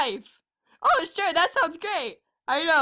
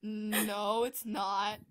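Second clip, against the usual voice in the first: normal talking voice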